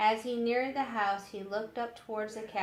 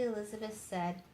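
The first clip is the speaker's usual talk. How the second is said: plain reading voice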